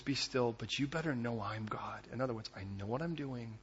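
low, very soft voice of God; quietly firm, hushed and low; calm assurance. Acted. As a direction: reassure them softly, in full control. God calmly reassures the anxious listener.

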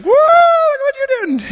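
high, loud, slow amazed cry; astonished exclaim. Retold or imitated. Elizabeth teasing mute husband, high amazed cry. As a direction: marvel at him out loud.

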